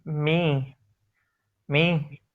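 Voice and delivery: normal talking voice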